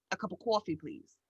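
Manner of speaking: put-on New York accent, "coffee" with an aw vowel. New Yorker repeating her order to the server, in New York accent. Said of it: "Be more New York".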